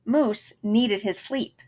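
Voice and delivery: plain reading voice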